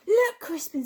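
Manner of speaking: high, loud cooing; doting mother. A narrator's reading. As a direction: coo over the new babies. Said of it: "said Missus Tamworth".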